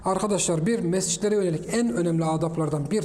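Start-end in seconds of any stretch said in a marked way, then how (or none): none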